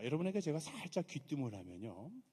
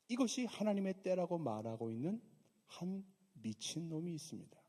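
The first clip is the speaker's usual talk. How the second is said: usual speaking voice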